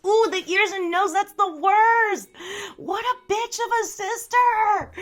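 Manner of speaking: high, loud, drawn-out horror; appalled reaction. Real, not acted. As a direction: squeal in sympathetic outrage, stretching the words. Reacting to viewer's gross ant story.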